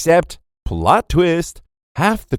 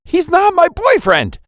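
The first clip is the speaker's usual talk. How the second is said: very high, indignant outburst; flustered yell; 4 dB louder than usual. A narrator's reading. Girl denying he's her boyfriend, flustered high yell. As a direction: burst out in high-pitched denial.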